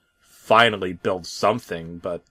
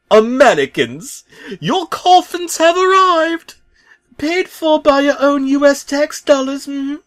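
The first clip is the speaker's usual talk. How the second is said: very high, loud, slow announcement; grand proclamation; 10 dB louder than usual. Acted. Bob announcing coffins to Americans, in a very high loud showman voice.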